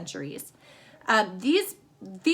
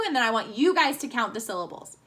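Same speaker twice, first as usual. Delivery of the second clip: ordinary talk, nothing marked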